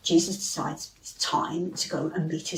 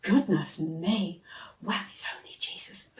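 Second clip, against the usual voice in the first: relieved whisper; 8 dB quieter than usual. Acted. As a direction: breathe out the relief softly. Relieved friends after the fright.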